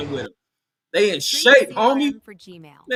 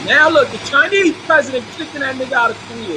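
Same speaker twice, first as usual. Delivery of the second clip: excited; 5 semitones higher, 7 dB louder than usual. Real, not acted. Excited commentary on Korean footage.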